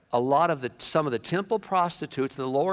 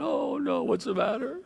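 mock whimpering Adam; 8 semitones higher, 4 dB louder than usual. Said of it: "he's in a corner crying".

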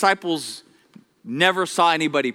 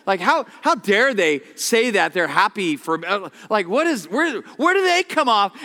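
mock outrage; 2 semitones higher than usual. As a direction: work yourself up in pretend offence. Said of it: "They're like".

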